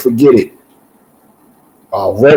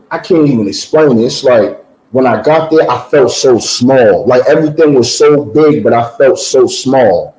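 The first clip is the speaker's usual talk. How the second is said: usual speaking voice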